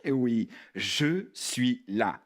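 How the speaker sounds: low, slow and soothing; reassuring. Acted. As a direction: comfort slowly in a deep voice. [name] reassures the frightened puppet.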